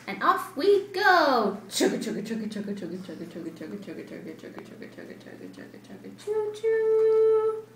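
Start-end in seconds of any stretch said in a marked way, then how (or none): 1.7-5.8 chugga chant fading to a whisper; fading train chugga. Acted. Train sound fading away. 5.8-7.6 train whistle. Acted.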